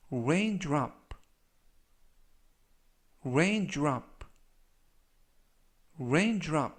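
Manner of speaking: "raindrop" said as REIN-drop, stress on rain; model pronunciation. Shown as a demonstration. Teacher repeating the word three times for learners, careful pronunciation. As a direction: say "raindrop" slowly, first syllable stressed. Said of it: "라고 발음하시면 되겠고요".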